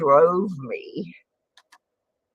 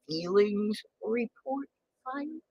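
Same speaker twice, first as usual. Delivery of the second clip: ordinary delivery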